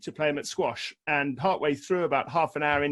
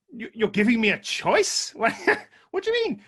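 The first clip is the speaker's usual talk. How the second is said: high, louder disbelief; incredulous; 8 semitones higher, 4 dB louder than usual. Retold or imitated. To the doctor offering a choice, high incredulous disbelief. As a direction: pitch it up in sheer disbelief. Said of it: "I said".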